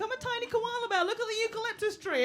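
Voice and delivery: lightly mocking cutesy voice; mock cutesy petite girl. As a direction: play the tiny cute girl, a touch fast at the end. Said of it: "They're like".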